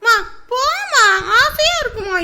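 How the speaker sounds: high, slow pleading to mum; whiny plea. Acted. Daughter coaxes mother to let her keep the sari on, high and drawn out. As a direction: coax her mother like a child.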